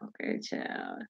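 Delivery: put-on low, slow, whispered okay child; dismissive okay child. Reacting to empty comments, a low slow whispered okay child. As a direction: brush it off under your breath. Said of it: "it's like".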